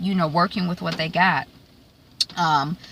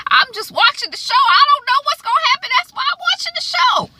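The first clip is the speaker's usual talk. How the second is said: high, fast, louder mock-frazzled rant; exasperated; 4 dB louder, faster than usual. Real, not acted. Vlogger fed up with making predictions, high frazzled rant. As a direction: throw up your hands, pitch way up.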